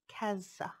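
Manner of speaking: usual speaking voice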